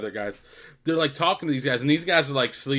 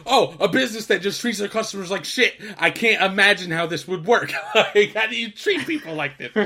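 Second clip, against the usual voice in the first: mock outraged rant, raised voice; freaking out; 6 semitones higher, 4 dB louder than usual. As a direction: imitate an appalled man, laughing through it. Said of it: "He's freaking the fuck out".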